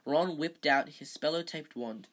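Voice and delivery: usual speaking voice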